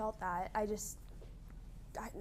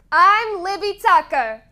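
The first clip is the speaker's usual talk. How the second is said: loud name call; 2 semitones higher, 7 dB louder, slower than usual. Shown as a demonstration. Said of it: "Do it again".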